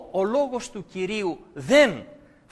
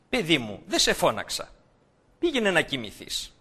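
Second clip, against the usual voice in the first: put-on gentle old priest; 7 semitones lower, 5 dB quieter than usual. Old priest Eli calming the boy, soft and gentle. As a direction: lower and softer, soothing the boy.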